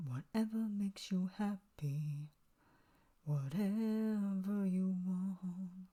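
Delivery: slow, soft, airy whisper; breathy whisper. Retold or imitated. Reviewer imitating the singer's slow breathy whisper. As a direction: breathe it out like a fragile confession. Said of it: "has that breathiness to him".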